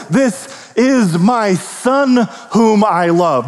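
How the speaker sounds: very slow, solemn heavenly voice; voice from the cloud. Acted. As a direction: stretch each word as a proclamation from above. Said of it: "the voice from the cloud say".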